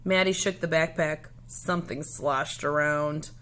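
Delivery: plain reading voice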